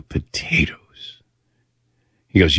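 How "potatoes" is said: slow, unvoiced disbelief; whisper. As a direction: whisper it back, stunned. Stunned whispered echo.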